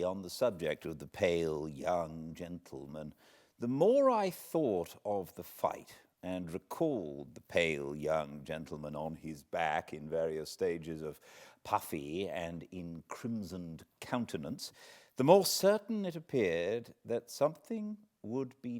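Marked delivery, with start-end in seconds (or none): none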